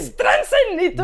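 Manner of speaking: high, loud fake German word; mock German. Co-host joining the joke, loud mock German accent. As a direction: join in the German parody, laughing. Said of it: "el único país que no sirve es el alemán".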